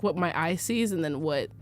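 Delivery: normal talking voice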